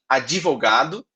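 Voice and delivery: "advogado" said as "ad-ji-vogado" with an extra i after the d; teacher shows Brazilians adding a vowel: "advogado" as "ad-ji-vogado"; with an added i sound. Shown as a demonstration. "advogado" with an inserted i after the d. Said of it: "A gente coloca um I".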